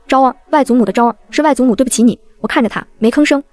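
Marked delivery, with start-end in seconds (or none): none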